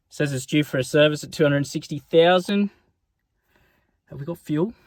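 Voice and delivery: ordinary talk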